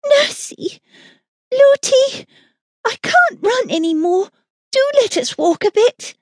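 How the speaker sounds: put-on very high child's voice, begging; pleading little princess. A narrator's reading. Princess begging her nurse to walk, high and pleading.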